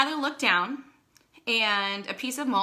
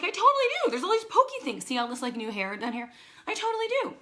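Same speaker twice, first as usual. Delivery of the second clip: insistent pitched up; 8 semitones higher than usual. Retold or imitated. Showing Kenny the new hair growth, high excited insistence. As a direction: squeak it out, sure of yourself. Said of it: "I'm like".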